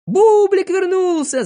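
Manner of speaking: high, drawn-out happy yell; jubilant, slow, ringing cry; joyful shout. A narrator's reading. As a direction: shout the glad news loudly. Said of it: "закричали все радостно".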